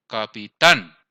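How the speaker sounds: "Capitán" with a loud, punched final "tán"; stress on last syllable. Shown as a demonstration. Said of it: "Cuál sería la sílaba tónica".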